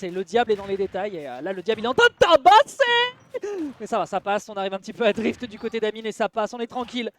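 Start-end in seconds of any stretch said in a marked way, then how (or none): 1.8-3.7 slow, loud and high, hyping it up; dramatic hype. Acted. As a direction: stretch it out like a hype man. (emphase dramatique, lente et forte)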